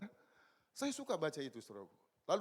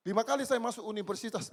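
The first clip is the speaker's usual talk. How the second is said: usual speaking voice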